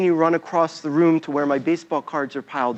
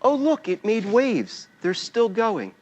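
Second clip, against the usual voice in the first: put-on childlike wonder; 4 semitones higher, slower than usual. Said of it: "I said".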